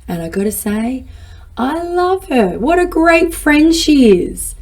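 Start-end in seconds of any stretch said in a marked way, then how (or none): 1.6-4.6 fond praise. Real, not acted. Praising her inner friend.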